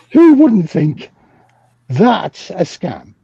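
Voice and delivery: drawn out, the start pitched high; slow it right down to land the point; slow emphatic. Real, not acted. Speaker asks the audience about the scam, slow and emphatic.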